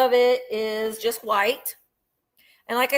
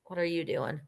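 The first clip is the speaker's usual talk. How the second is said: ordinary delivery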